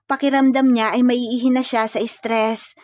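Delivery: plain reading voice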